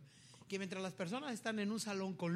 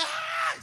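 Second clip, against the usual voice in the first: loud, high movie-theatre shriek; imitated scream; 12 dB louder than usual. Congregation hears preacher mimic moviegoers, loud scream. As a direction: shriek like the whole cinema. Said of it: "todo el mundo grita".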